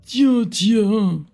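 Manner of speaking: tiens tiens said high and creaky; old man voice. Shown as a demonstration. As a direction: drawl "Tiens, tiens" like an old elder. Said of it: "J'essaie de faire une voix de vieux".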